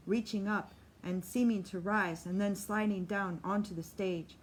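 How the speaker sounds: usual speaking voice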